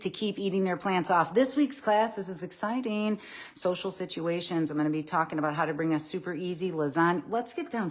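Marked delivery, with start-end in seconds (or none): none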